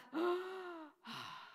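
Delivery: high drawn-out gasp; long scared intake of breath; frightened gasp. Acted. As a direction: gasp in sudden fear. Mary still afraid.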